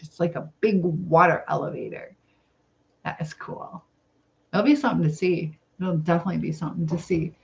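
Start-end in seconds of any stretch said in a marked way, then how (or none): 3.0-3.8 whispered aside; just breathe the words, no voice; a soft whispered remark. Real, not acted. Aside, unvoiced.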